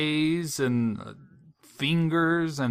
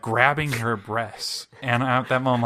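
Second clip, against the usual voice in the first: ordinary talk, nothing marked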